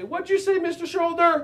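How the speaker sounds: mock question to a shoulder; playful call. Shown as a demonstration. Teacher modeling a line kids repeat to their shoulder.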